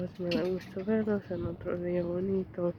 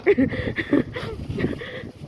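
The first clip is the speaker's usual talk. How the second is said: wheezing high laugh; real breathless giggle; breathy high giggle; 13 semitones higher, 4 dB louder than usual. Real, not acted. Laughs again.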